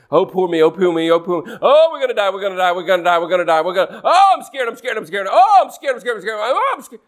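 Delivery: whiny mock panic, pitch jumping up on each oh; hammed-up scared complainer; mock whining fear. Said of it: "Stop it".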